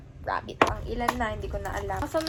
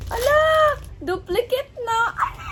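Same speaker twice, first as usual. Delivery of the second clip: high, loud yelp of dismay; shocked outcry; 8 semitones higher, 6 dB louder than usual. Real, not acted. Vlogger pulling a duplicate figure, shocked high outcry. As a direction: cry out at an unwelcome surprise.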